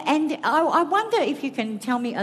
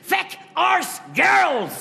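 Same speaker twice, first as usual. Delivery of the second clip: shouted catchphrase; 8 semitones higher, much slower than usual. Retold or imitated. Shouting the catchphrase at a comedy audience. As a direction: bark it out like a mad old priest. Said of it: "can't even leave this stage without saying".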